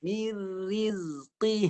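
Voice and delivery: Mir rizqi slowly, qi thick from the tongue root; thick qaf recitation. Shown as a demonstration. Teacher models thick qaf for the student. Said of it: "Cuba qaf pangkal lidah naik".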